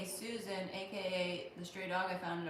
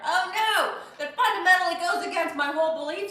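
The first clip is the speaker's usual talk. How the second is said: much higher and louder cry of dismay; shocked outcry; 11 semitones higher, 14 dB louder than usual. Real, not acted. Streamer reacting to Shannon's money-on-the-tray tip, a loud shocked cry. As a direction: yelp as if your belief system is under attack.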